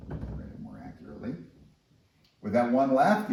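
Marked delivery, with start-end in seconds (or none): none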